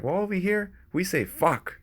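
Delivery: lightly voiced Gary Vee quote; imitating Gary Vee. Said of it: "That's what Gary Vee said".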